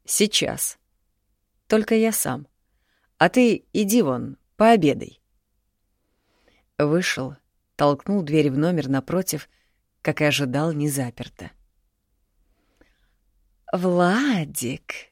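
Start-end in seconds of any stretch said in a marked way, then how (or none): 0.1-5.2 higher, guilty father sending son off; a lightly voiced older father; father's voice. 13.7-15.1 lightly voiced coy woman singing his name; sing-song call. A narrator's reading. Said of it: "пропела Марина".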